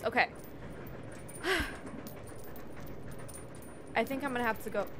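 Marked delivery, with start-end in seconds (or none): 0.3-3.9 long weary sigh. Real, not acted. Tired of inventory.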